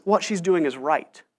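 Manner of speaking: soft, slow and hushed; gentle defence. Retold or imitated. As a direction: defend her gently, almost under your breath. Jesus defends the weeping woman.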